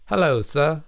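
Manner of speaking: a jokey, posh-sounding British hello, sir; mock British accent. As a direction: greet like a London gent, clearly put on. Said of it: "What kind of accent is that?".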